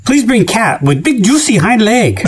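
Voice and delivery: hammed-up Chinese accent punchline; mock Chinese accent.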